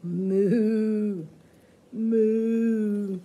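loud mooing cow. Acted. Answering the children's question with a loud cow moo. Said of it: "what did the cow say?".

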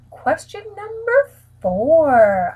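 slow, drawn-out announcement; announcer voice. Acted. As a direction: announce it like a game show. Announcing the next question.

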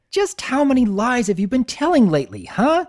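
high, loud angry demand; growled accusation. Acted. Twilight confronting Spike about his lies, angrily. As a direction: snap at him with an accusation. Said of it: "as she growled".

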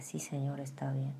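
soft submissive whisper; hushed yes to the Lord; whispered obedience. Retold or imitated. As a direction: yield to God quietly.

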